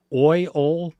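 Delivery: comparing the two ways to say oil. Shown as a demonstration. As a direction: say oi, then flatten it to ol. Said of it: "say it like ol".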